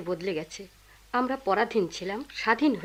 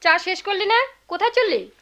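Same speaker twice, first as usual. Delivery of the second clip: high, raised questioning call; calling after someone; 9 semitones higher, 4 dB louder than usual. Acted. Mother to son leaving his tea, calling after him.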